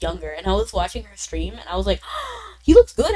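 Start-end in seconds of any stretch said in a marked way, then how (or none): 2.1-2.6 sharp high gasp of admiration; acted-out delighted gasp; mock gasp. Retelling reaction to a screenshot. 2.6-3.1 high, loud gushing; acted-out excited reaction; excited admiration. Retelling reaction to a screenshot.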